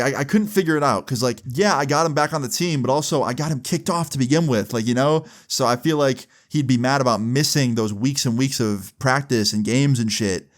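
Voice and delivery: ordinary delivery, nothing marked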